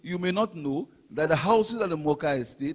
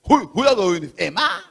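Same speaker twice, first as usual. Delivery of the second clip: louder mock question leaping high on the name; mimicked disbelief; 4 semitones higher, 6 dB louder, faster than usual. Party speaker mimics an opponent doubting Emma, mock disbelief. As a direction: imitate an incredulous rival. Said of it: "Guy Joseph".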